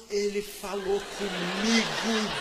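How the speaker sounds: celebrating that God spoke; excited. Real, not acted.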